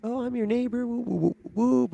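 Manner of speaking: high, sing-song neighbor lady greeting; mock high lady voice. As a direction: chirp it up high like an overeager neighbor. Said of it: "she's like".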